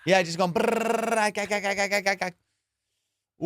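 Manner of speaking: slow juddering brrr and gah sounds; flapping in the wind noises. Retold or imitated. Friend imitates naked skydiver's flapping, with slow juddering gah noises. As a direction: mimic a body flapping in freefall wind.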